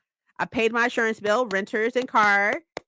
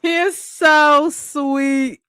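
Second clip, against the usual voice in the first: gushing; 6 semitones higher, 6 dB louder, much slower than usual. Real, not acted. Gushing about husband.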